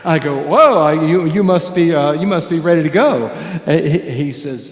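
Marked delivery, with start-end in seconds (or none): 0.4-3.3 put-on louder, eager whoa to the kid; match his bouncing energy; excited greeting. Musician greets boy jumping on bed, loud and excited.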